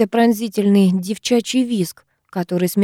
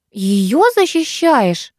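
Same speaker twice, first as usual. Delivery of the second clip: higher, drawn-out incredulous challenge; indignant; 4 semitones higher, much slower than usual. A narrator's reading. Викуля challenging friend for defending newcomer, indignant. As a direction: question it slowly, outraged. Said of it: "возмутилась подруга".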